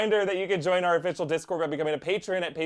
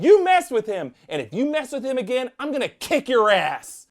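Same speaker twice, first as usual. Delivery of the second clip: high, loud bully voice; scary kid threatening; 9 semitones higher, 6 dB louder than usual. Retold or imitated. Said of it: "scary kid comes up to me".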